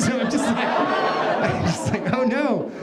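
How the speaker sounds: laughing through the words; giggling as it goes wrong. Real, not acted. Telling a friend the chaos, laughing along.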